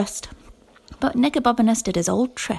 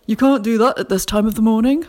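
louder, gruff official challenge; stern night watchman; 5 dB louder than usual. A narrator's reading. Night watchman challenging two children out at dawn, stern and loud. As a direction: bark it like a guard on patrol.

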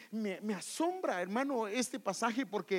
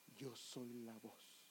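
soft, slow and hushed, voice held low; hushed quote; 8 semitones lower, 18 dB quieter, much slower than usual. Acted. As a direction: let the words fall almost silent. Preacher quotes John the Baptist. (cita en voz baja y lenta)

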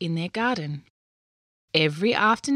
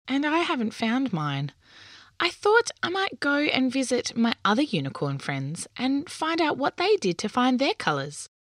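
put-on much higher, childlike unicorn voice, a bit wistful; little unicorn; 6 semitones higher than usual. A narrator's reading. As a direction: play the young unicorn explaining its problem.

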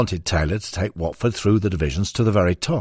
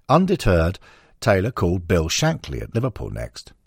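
ordinary delivery

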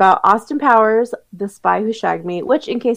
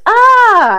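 a sharp high mock yelp, louder; 12 semitones higher, 6 dB louder than usual. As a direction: yelp like a startled cartoon hero. Austin yelps.

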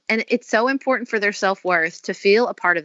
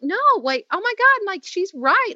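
high, startled self-correction; dawning realisation; 11 semitones higher than usual. Retold or imitated. Mother admitting to herself the therapist is right, startled. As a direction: replay catching yourself, pitch leaping up. Retold realisation about crying.

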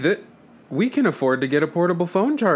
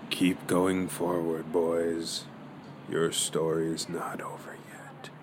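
mysterious whisper; 7 semitones lower, 11 dB quieter, slower than usual. Acted. Mysterious voice urges them on.

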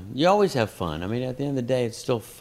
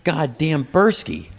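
slow, drawn-out, louder groan of a name; exasperated curse; 5 semitones higher, 5 dB louder, much slower than usual. Retold or imitated. Comic cursing his old opener by name, slow and exasperated. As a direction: drag the name out in disgust.

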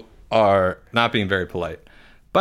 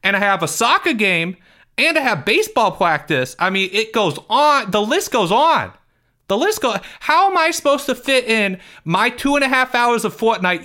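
put-on whiny, higher kid voice with big complaints; Porge; 12 semitones higher than usual. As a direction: play Porge, indignant and put-upon. Host voices character Porge.

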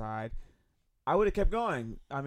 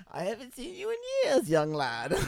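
put-on high, creaky old man voice; 10 semitones higher than usual. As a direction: play a kindly old man greeting someone. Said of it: "that's my old man voice".

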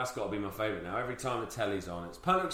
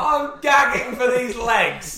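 high, loud, slow drawn-out wail; mock mermaid; 12 semitones higher, 11 dB louder, much slower than usual. Friend parroting the mermaid's line, high, loud and slow. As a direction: stretch it out in a shrill mock. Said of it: "mocking the mermaid".

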